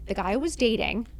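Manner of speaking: normal talking voice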